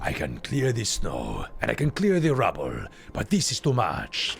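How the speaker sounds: mock French accent drifting toward Spanish; slow, hammed-up French-Spanish accent; wandering foreign accent. Streamer playfully voicing game dialogue, wandering French-Spanish accent. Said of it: "my accent, which is now Spanish".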